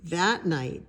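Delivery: ordinary delivery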